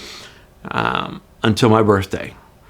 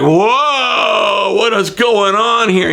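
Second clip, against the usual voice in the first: high, alarmed "whoa"; startled cop; 9 semitones higher, much slower than usual. Retold or imitated. Cop startled by a weeping driver. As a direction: react like you've found someone in crisis. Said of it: "and he's like".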